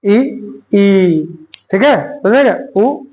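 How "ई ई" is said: ई held long like a long vowel; long drawn ई. Shown as a demonstration. Teacher drawing out ई for students to hear the long vowel. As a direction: stretch ई out long, held for two beats. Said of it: "दीर्घ ई को ई ऐसे बोलेंगे".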